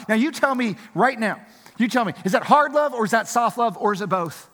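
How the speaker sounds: high, loud pulpit call to repent; fiery preaching. Acted. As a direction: press the sinners hard from the pulpit. Preaching at full force.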